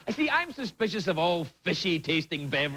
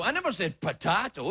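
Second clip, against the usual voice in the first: ordinary talk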